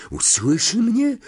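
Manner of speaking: put-on a terrified boy's voice cracking upward; unnaturally high voice. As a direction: shoot the voice way up in fright. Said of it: "nienaturalnie wysokim głosem".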